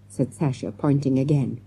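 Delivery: usual speaking voice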